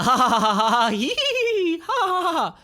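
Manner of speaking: high, loud cackling laugh; manic machine cackle. Acted. Geiger counter cackles at the mountaintop, high and loud. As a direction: cackle like a crazed gadget. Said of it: "noise coming out of the Geiger counter".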